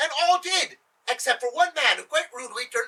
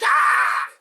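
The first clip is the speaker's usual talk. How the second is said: high, loud enraged scream; 6 semitones higher, 7 dB louder than usual. A narrator's reading. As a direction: scream out a banishment. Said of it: "and he screamed".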